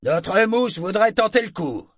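high, loud announcement to a crowd; calling out. Acted. Shipmate volunteers the cabin boy to everyone, calling out. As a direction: proclaim it over the crowd.

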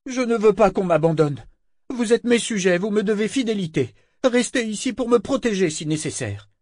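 high-pitched, peevish anger; angry governor. Acted. The governor demands his subjects protect him, mildly angry. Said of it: "le visage fripé de colère".

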